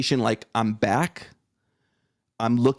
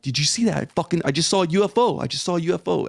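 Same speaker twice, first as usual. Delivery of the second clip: higher, insistent re-enactment; retold excitement; 6 semitones higher, faster than usual. Father re-enacts telling his son of the UFO, mildly excited. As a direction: blurt it with the pitch lifted, not shouted.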